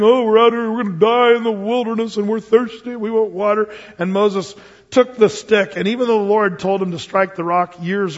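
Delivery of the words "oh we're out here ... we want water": higher, louder whiny grumbling; a hint of mock complaining Israelites; complaining people. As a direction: whine like a thirsty crowd in the desert. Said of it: "the people were murmuring".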